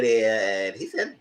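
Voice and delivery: normal talking voice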